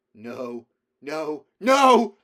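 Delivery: put-on a boy's frantic no rising to a shriek; anguished shout. A narrator's reading. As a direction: scream it out as he loses control. Boy breaking down in panic.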